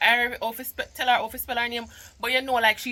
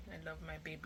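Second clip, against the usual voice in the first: tender, softer; 6 semitones lower, 20 dB quieter, faster than usual. Real, not acted. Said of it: "tender repeat".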